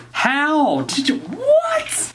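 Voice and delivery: very high, slow drawn-out what; floored by the guess; stunned. Real, not acted.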